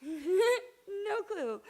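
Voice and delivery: laughing high and loud through tears; nervous laughing. Real, not acted. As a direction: laugh it out, close to crying. Nervous laughing while admitting cluelessness.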